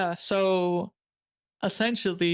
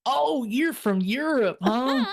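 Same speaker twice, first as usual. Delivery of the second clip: slow, higher-pitched Southern drawl in imitation; Southern accent; 5 semitones higher, slower than usual. Owner reacts to the visitor from Europe, in a Southern accent. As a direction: mimic an intrigued Southern local. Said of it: "He talked like this".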